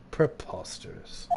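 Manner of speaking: low, hushed dismissal; quiet, low-pitched disdain; dismissive scoff. Acted. Courtroom dismissal of the accusation.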